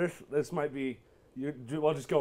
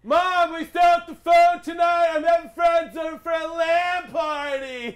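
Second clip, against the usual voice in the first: put-on yelling teen; 13 semitones higher, 9 dB louder than usual. Teen character yelling to mom.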